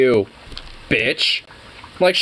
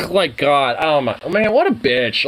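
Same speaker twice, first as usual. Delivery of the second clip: normal talking voice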